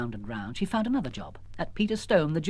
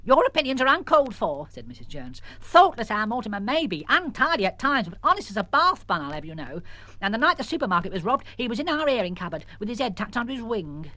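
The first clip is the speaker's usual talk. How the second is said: higher, indignant defence; indignant mother; 6 semitones higher than usual. Acted. Missus Jones defending Mortimer to the sergeant, indignant. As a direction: stand up for your bird.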